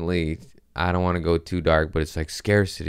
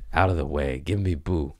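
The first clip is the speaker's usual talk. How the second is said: ordinary talk, nothing marked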